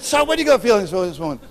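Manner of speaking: high, loud and fast repeat; loud, fast retort. Retold or imitated. Preacher repeats his challenge to the neighbour, fast and loud. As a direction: fire the question back harder. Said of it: "I said".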